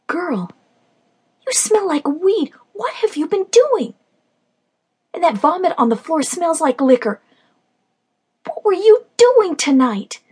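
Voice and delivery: high, loud angry demand. Acted. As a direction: interrogate her sharply. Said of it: "I demanded".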